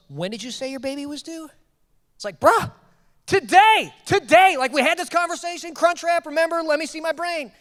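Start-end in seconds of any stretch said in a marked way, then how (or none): 0.1-1.5 higher, hushed, tentative question; softly acted unease; nervous. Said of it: "He's really nervous". 2.4-7.5 high-pitched, incredulous outburst; mock-indignant protest, pitched way up; exasperated. Acts out exasperated reply to technician.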